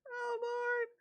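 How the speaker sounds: laughing exclamation. Real, not acted. Laughing reaction.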